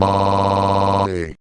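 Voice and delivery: drawn-out fake moan; hammy held moan; mock moan.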